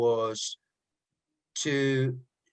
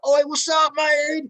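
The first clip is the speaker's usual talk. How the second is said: higher and louder Cockney "Oi, what's up, mate"; Cockney greeting; 14 semitones higher, 7 dB louder than usual. Retold or imitated. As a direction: hail a mate in broad Cockney. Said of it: "I sound a bit like a Cockney".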